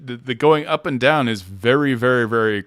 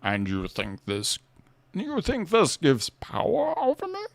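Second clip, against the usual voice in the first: Bane impression; 13 semitones higher, slower than usual. Demonstrating how Bane talks, slow and swooping. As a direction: swing the pitch like a villain's speech. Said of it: "That's how Bane talks".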